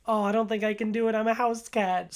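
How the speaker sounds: high, fast, fretful excuse; imitating a timid waverer; mock second thoughts. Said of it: "starts to get second thoughts".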